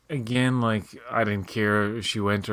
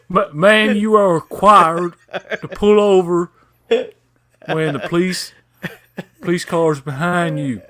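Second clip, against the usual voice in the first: higher, louder, slow Florida cop drawl; Southern cop impression; 7 semitones higher, 7 dB louder, slower than usual. Cop pulling over the mother, loud slow Southern drawl. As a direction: lay on the thick Florida cop voice. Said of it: "did an impression of a cop down in Florida".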